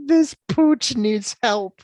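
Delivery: very high, slow hammed-up hero line; exaggerated parody of an earnest hero; mock Babe Ruth. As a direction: mimic the corny movie delivery, drawn out. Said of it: "It's literally what he said".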